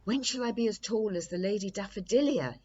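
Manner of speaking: cried eagerly. A narrator's reading. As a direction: blurt out the question in a rush. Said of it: "he cried".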